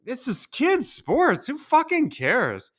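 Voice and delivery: drawn-out exasperated plea. Retold or imitated. Dad fed up with screaming parents, slow and exasperated. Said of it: "And I'm like".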